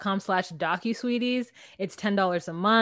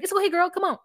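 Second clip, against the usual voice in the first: high mock coaxing, a touch louder; coaxing Amber; 9 semitones higher, 5 dB louder, faster than usual. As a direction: coax her in, higher than usual. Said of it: "she's like".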